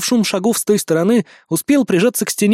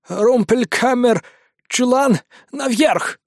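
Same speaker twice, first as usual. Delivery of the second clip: put-on slow, high German-accented broken words; foreign accent; 6 semitones higher, slower than usual. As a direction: point the way in broken speech. Captive answers in broken Russian.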